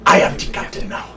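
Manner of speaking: low, hushed movie-pirate quote; quietly menacing captain impression. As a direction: murmur it like a pirate taking command. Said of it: "happen to use the phrase".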